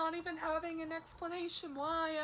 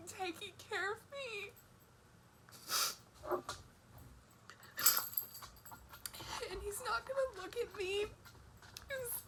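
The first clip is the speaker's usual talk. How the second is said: sobbing, higher tearful voice; blubbering; 3 semitones higher than usual. Real, not acted. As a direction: let the sobs break the words. Crying about partner's burden.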